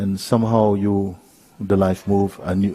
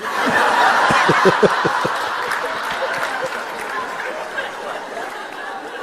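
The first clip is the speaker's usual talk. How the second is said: long real breathy giggle rising high; long amused giggle climbing; long laugh. Real, not acted. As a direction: laugh it off at length. Laughing at own confession.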